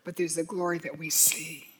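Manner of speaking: a soft, unvoiced whisper of awe. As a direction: breathe the line out in a hush. Hushed line on seeing glory.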